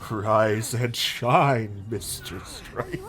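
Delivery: very slow, menacing drawl; slow drawn-out greeting. Acted. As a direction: stretch each word like a smug villain. Quoting game villain line.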